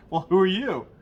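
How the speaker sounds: high, loud surprised question; startled question. Retold or imitated. Burglar calls out to the parrot, loud surprised question. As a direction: call out to the unseen voice.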